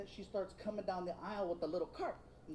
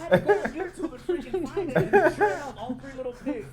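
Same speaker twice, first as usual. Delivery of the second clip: louder, laughing through the words; 4 semitones higher, 13 dB louder than usual. Real, not acted. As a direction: crack up while telling it. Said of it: "laughing through story".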